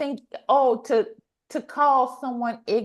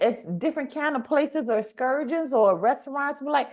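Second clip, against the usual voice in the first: ordinary delivery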